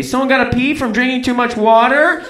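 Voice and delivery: high teasing taunt, rising at the end; imitated jeer; mocking. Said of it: "They made fun of me".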